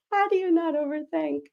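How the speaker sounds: laughing through tears. Real, not acted. Laugh-crying at comment.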